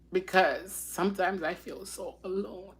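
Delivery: soft, halting, tearful confession; choked-up and hushed; real crying. Real, not acted. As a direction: admit it quietly through tears. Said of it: "I don't know why I'm crying".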